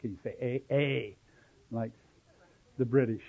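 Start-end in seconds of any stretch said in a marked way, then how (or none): 0.4-1.2 A as the vowel in "they"; long A sound. Shown as a demonstration. Teacher asking class to say A, pronounced "ay". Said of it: "the E is pronounced A".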